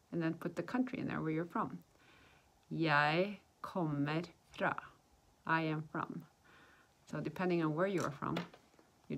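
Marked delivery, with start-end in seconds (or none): 2.7-4.8 very slow, deliberate words; slow teaching model. Shown as a demonstration. Teacher showing how to answer where you're from, slow demonstration. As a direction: measure out each word for learners.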